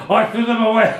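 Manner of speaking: high-pitched dramatic imitation; hammed-up intense outburst; mock intense. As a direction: play the fed-up flatmate, high. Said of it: "like, all intense".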